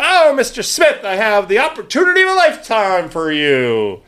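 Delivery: oversell it like a cold caller; telemarketer pitch. Retold or imitated. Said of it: "when you answer one of these spam calls".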